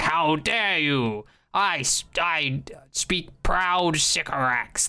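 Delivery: slow, grand put-on outrage; hammed-up alien leader taking offence; mock-indignant alien. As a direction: draw out each word, proudly offended.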